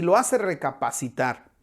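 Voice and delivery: ordinary talk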